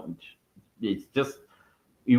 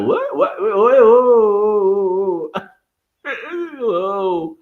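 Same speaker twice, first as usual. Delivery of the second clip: high, loud, slow gasping whoas; mock shocked whoa; 8 semitones higher, 5 dB louder, slower than usual. Creator acting his reaction to leaked news, stunned whoas. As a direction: act out being floored by the news. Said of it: "I'm like".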